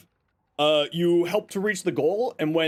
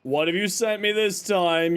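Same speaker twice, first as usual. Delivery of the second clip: ordinary talk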